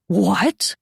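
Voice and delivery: usual speaking voice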